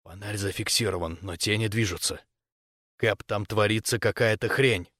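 low, soft, wary half-whisper; hushed whisper. A narrator's reading. Corporal reporting moving shadows in the tunnel, hushed. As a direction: report it under your breath. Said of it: "очень тихо прошептал Квон".

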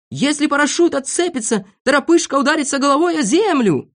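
high, urgent warning; alarmed shout. A narrator's reading. Doctor warns friends about dangling jumper, shouting. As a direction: cry out the danger at high pitch. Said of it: "закричал доктор Пилюлькин".

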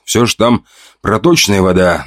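ordinary delivery

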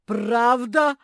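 high, very loud, rising yell; insistent yell. A narrator's reading. Bear cub in a squabble, insistent yell. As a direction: hammer it home at full volume. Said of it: "твердил сын медведицы Розы".

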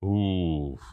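understated sigh of relief; a small "phew"; exhaled relief. A narrator's reading. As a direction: let the word out on an exhale. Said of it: "выдохнул Арнольд".

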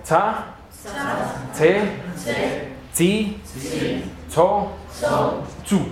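"tsa, tse, tsi, tso, tsu" said high and spaced out; "tsa, tse, tsi, tso, tsu" said slowly, one syllable at a time; syllables for the class to repeat. Shown as a demonstration. Teacher leading the class in a repeat-after-me drill. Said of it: "Repitan conmigo".

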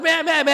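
high mock bleating, may may may; mock goat bleating. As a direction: bleat like a frightened lamb. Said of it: "అని ఏడిచింది".

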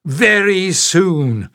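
very slow sinister hiss; slow hissing threat. A narrator's reading. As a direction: drag it out, menacing. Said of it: "she hissed".

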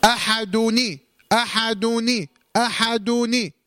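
أحدني said with the added ني, the nun of tanween carrying a kasra; linking with kasra. Shown as a demonstration. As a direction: sound out أحدني with a clear ni ending. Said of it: "اكسر بقى أهو".

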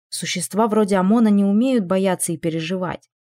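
ordinary delivery, nothing marked

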